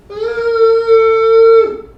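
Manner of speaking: very high, loud drawn ooh; bourbon burn. Real, not acted. Friend sipping bourbon: high, loud ooh.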